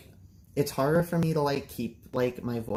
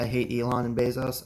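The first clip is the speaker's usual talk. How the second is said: ordinary talk, nothing marked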